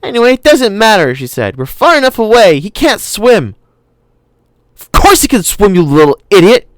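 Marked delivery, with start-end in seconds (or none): 0.0-1.1 put-on high, loud little girl voice; a stubborn kid talking back; girl assuring her brother, voiced high; young girl. Lex voiced in the story. 1.6-3.6 put-on high, loud little girl voice; a stubborn kid talking back; girl assuring her brother, voiced high; young girl. Lex voiced in the story. 4.8-6.7 put-on high, loud boy yelling at his sister; boy shouting at his sister in a boat. A narrator's reading. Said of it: "Tim shouted at her".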